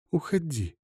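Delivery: soft, high female plea; hushed dismissal. A narrator's reading. Woman pushing Bogdan away, hushed. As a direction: send him off in a small voice.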